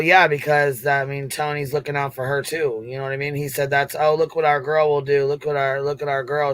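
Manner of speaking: ordinary talk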